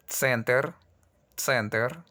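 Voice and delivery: "Center" with a ts start and tiny vowel before r; tsent-er, a small vowel slipped in; Russian центр. Shown as a demonstration. Teacher to students, tsenter with slipped vowel. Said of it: "Es la palabra centro".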